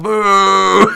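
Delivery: very high, loud mock boo; crowd boo. Irish crowd booing the decision, loud mock boo. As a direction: boo like an angry hometown crowd.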